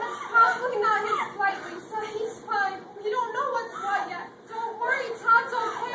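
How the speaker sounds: loud, urgent comforting; frantic reassurance. Acted. Friend frantically reassuring the sobbing wife, loud and urgent. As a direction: talk her down in a rush.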